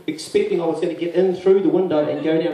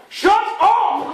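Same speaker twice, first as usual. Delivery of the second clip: high, loud mock neighbour shout; hammed-up cry to shut up; angry yell; 4 dB louder than usual. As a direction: bellow it down the street. Neighbour yelling from down road.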